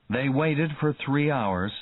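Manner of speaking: ordinary delivery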